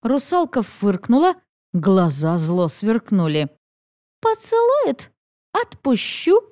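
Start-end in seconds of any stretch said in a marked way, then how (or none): 4.2-6.4 high, teasing mermaid voice; sly mermaid. Acted. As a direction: bargain slyly, up high. Mermaid sets terms.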